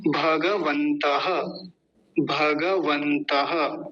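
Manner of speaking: भगवन्तः slowly with full ga and final "ha"; say-along pronunciation. Shown as a demonstration. Teacher leading repetition, slow syllabic pronunciation. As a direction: draw out bha-ga-van-ta-ha in भगवन्तः. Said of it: "मेरे साथ बोलिए".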